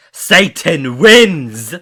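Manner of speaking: loud, drawn-out evil victory cry; villain triumph shout. Acted. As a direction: proclaim the win like a gloating devil.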